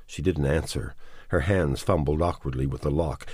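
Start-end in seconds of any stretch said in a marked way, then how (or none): none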